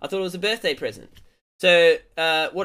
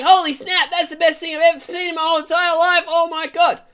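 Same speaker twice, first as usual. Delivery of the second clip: high, loud, rapid gushing; mock mind-blown excitement; amazed outburst; 11 semitones higher, 5 dB louder than usual. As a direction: gush breakneck in hammed-up awe. Acted amazement at piglet backflip.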